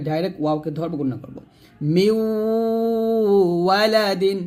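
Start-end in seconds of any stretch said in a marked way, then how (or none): 1.9-4.4 মিউ glided straight into w on a long nasal hum; merged ghunnah. Shown as a demonstration. Teacher joining mim to waw, long nasal মিউ. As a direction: drop the n, hold মিউ nasally into ওয়ালাদিন. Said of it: "মিউ বলতে হবে".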